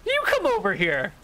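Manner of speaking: high-pitched character voice calling over; high, challenging spellcaster; enemy spellcaster. Acted. Game master voices the enemy spellcaster.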